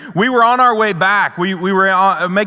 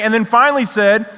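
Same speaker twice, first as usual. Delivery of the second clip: usual speaking voice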